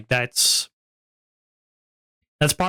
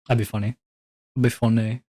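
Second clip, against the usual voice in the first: ordinary talk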